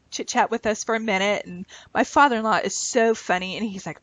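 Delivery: ordinary delivery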